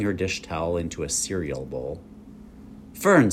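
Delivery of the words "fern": sharp, high stern mother calling Fern; a mother's stern rebuke. A narrator's reading. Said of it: "said her mother sternly".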